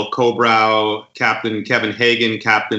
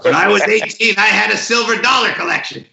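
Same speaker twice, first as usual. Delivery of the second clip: imitation; 10 semitones higher than usual. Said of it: "I can't do the imitation".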